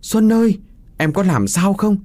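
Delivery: high, urgent calling; high-pitched cry of concern; worried calling out. A narrator's reading. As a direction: call anxiously to a hurt loved one. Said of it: "Hoài từ phía trước kêu lên".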